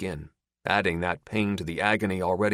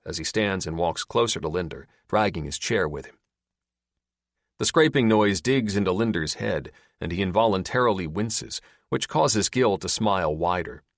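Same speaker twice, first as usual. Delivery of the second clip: usual speaking voice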